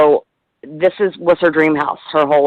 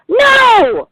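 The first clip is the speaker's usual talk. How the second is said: a much higher, louder mock yell of no; mom's yelled no; 3 dB louder than usual. Retold mom on the phone yelling no at her daughter. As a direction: squeal the no like an overwhelmed mom. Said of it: "Like, she screams".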